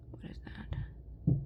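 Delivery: slow, breathy whisper of puzzlement; puzzled whisper. Real, not acted. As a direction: whisper it to yourself, squinting at the mark. Puzzling over earring stamp.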